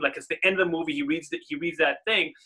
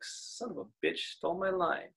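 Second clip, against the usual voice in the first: soft, half under the breath; muttered movie quote; 3 semitones higher, 12 dB quieter than usual. Retold or imitated. Robin Williams muttering the line after reading the letter, soft. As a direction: say it quietly like an aside to yourself. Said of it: "he's like".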